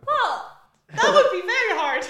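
loud, high-pitched mock protest; playful exclamation. Real, not acted. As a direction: burst out in amused objection. Jokes about juggling a skittle.

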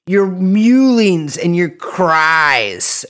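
slow, drawn-out grandiose delivery; mock-solemn. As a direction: stretch it out with mock pity. Mock-solemn answer to listeners' pleas.

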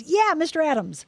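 high, rousing exclamation; emphatic cheer. Retold or imitated. Husband suddenly hailing her late dad, emphatic cheer. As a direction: call it out like a fist hitting the table. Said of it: "puts his fist down on the table".

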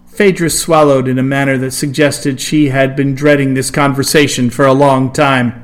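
ordinary delivery, nothing marked

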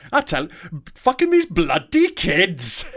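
put-on slow, drawn-out Welsh accent. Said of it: "Can you do a Welsh accent".

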